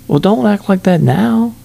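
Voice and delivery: high, mock-scolding tease; playful mock reproach; teasing. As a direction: needle her playfully. Teasing the neighbour woman.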